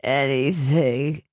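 low, slow muffled wail; acted smothered sob of a word; muffled bawling. A narrator's reading. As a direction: moan it as if head buried in arms. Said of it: "his muffled voice bawled".

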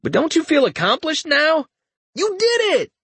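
evil smiling praise. Acted. Richter taunting his friend with gloating mock cheer. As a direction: praise him like mocking a child. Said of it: "an evil smile".

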